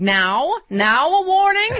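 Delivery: mocking House Republicans. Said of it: "The House Republicans are like".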